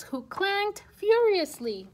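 plain reading voice

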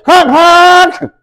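very high, loud imitation horn; honking car horn noise. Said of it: "by honking my horn".

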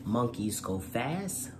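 usual speaking voice

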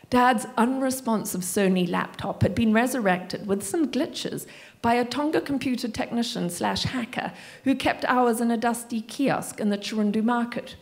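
usual speaking voice

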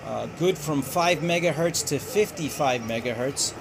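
ordinary talk, nothing marked

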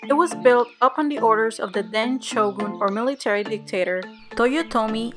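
travel show host. Acted. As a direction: clear tour guide narration. Narrates castle tour voiceover.

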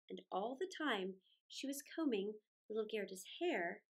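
ordinary delivery, nothing marked

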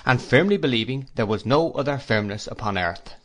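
ordinary delivery, nothing marked

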